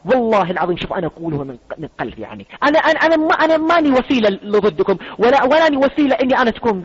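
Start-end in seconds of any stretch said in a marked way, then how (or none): 2.6-6.8 high, loud urgent plea; earnest raised appeal; raised pleading. Real, not acted. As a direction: plead with them, voice lifted and pushed. Emotional plea to Shia viewers.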